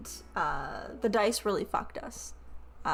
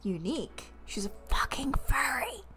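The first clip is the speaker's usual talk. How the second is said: whisper; 5 semitones higher than usual. Real, not acted. Whispered aside joke.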